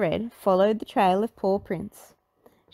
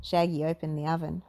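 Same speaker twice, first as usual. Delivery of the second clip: ordinary delivery, nothing marked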